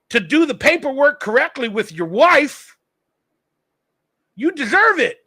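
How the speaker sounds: ordinary delivery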